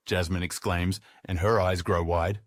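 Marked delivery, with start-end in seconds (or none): none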